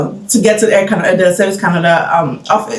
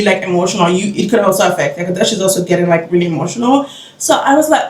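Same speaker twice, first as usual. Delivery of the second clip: ordinary talk, nothing marked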